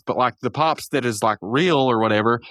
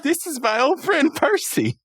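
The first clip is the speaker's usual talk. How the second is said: high, slow cartoon-character voice; proud character introduction; 12 semitones higher than usual. Podcaster voices Pops introducing the doll, slow high showing-off. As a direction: present it slowly, pitched well up.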